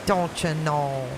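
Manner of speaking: slow, drawn-out Midwestern vowels; hammed-up Minnesota-style accent; mock Upper Midwest accent. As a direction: savour the northern accent slowly. Repeats the accented catchphrase.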